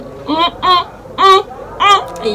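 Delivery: high delighted mm sounds; savoring hums. Real, not acted. Vlogger humming in delight at Yemi's pidgin. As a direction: hum like tasting something delicious.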